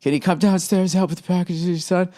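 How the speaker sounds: lightly mimicked wife asking; a hint of the wife's request voice; imitating wife's request. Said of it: "I said it angrily".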